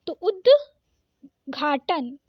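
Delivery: उद्घाटन stretched very slowly, द clearly before घ; drawn-out pronunciation. Shown as a demonstration. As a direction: pull उद्घाटन out slowly, half द then घ. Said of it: "उद्घाटन ऐसे". (उद्घाटन बहुत धीरे खींचकर, आधा द फिर घ)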